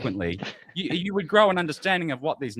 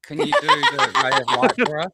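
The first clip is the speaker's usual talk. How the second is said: laughing; 12 semitones higher, 3 dB louder than usual. Real, not acted. Laughing request during stream.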